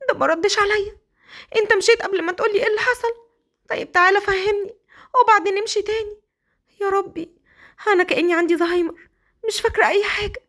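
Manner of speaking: high, fretful wife talking to herself; bewildered and flustered, high; confused wife. Acted. (سيليا محتارة بتكلم نفسها بصوت عالي)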